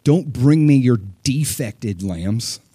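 very slow, each word weighted; deliberate, stern warning; stern slow rebuke. Retold or imitated. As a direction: lay down the rule firmly. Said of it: "He's like".

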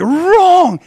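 high, loud outburst on one drawn-out word; erupting teacher. Retold or imitated. Teacher erupts at the student's speech, loud outrage. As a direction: blast it out as a sudden verdict. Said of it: "erupts out of her chair".

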